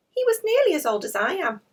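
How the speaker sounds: put-on high, loud tearful girl's voice; a child fighting back tears. Maureen tells Alfie's family tearfully. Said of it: "Maureen told them tearfully".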